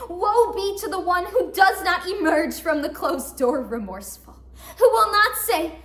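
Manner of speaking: loud, declaimed warning; mock dire proclamation. Woman warning whoever comes out unremorseful, loudly declaiming. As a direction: thunder it out like a curse.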